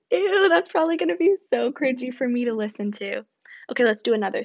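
ordinary talk, nothing marked

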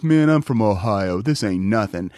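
low, slow, unbothered tough-guy voice. Retold or imitated. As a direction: shrug off the cold, deep and slow. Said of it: "he goes".

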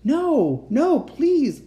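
high, loud desperate plea; begging. A narrator's reading. Captive child to the uncle, begging. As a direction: beg with the voice pitched right up. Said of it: "I begged".

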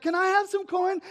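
high-pitched imitation of a child; little girl. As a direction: pipe up like an eager small girl. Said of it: "this little girl comes running in".

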